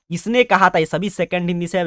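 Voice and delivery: usual speaking voice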